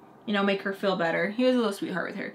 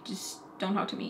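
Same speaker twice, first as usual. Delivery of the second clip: whispered and much softer; under-breath whisper; 11 dB quieter than usual. Retold or imitated. Whispering her silent reply to the annoyed man. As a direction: breathe it out unvoiced like a private thought. What she felt toward him.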